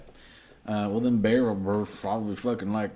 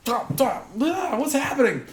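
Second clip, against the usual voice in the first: high, flustered mock panic; hammed-up high-pitched confusion; disoriented panic; 11 semitones higher than usual. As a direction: cry out as if knocked dizzy. Game master acts disoriented character.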